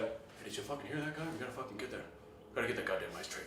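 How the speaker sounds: very soft, low secretive aside; hushed conspiring. Retold or imitated. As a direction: confer quietly, as if plotting.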